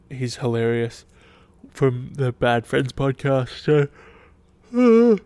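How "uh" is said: a loud, high yawn, real and unguarded; big yawn. Real, not acted. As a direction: let a loud yawn escape. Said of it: "sorry for yawning again".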